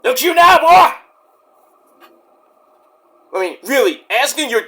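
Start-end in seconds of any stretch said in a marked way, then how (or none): 0.0-1.0 loud, higher mock taunt; a loud, high, mocking jeer. Mocking the Rock.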